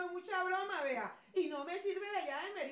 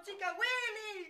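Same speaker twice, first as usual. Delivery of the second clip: tearful; 5 semitones higher, 5 dB louder, slower than usual. Acted. Heartbroken over lost Willy.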